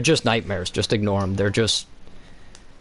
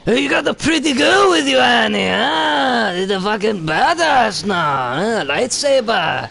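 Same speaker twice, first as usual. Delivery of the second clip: much higher, loud, slow, drawn-out mocking creature voice; Watto impression; 10 semitones higher, 3 dB louder than usual. Watto greeting grown-up Anakin, high loud mocking drawl. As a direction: ham up a greedy junk dealer taunting. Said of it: "And then he's like".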